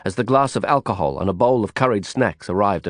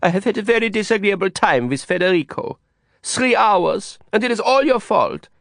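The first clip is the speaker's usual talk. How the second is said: higher, whiny complaint with a hint of an accent; a pouting girl blaming her friend; lightly voiced sulky young woman; 11 semitones higher than usual. A narrator's reading. Ulla complaining.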